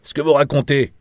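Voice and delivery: loud, high-pitched burst; belched-out jeer. A narrator's reading. Bad cop scoffing at the witness's story, belched out loudly. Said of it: "a-t-il éructé en anglais".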